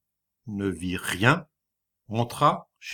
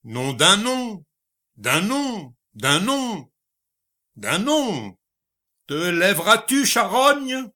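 furious thundering voice; 9 semitones higher, slower than usual. Acted. Drowned husband bellowing at his cowering wife, furious thunder. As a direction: roar it like a drowned man's fury. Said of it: "la voix de tonnerre du noyé qui vociférait".

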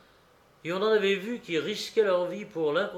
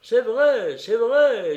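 high, loud acclaim; shouted agreement; 9 semitones higher, 11 dB louder, slower than usual. A narrator's reading. Soldiers answering their general's praise, loud shouted assent. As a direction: call out agreement as an eager crowd. Crowd loudly agrees with Giscon.

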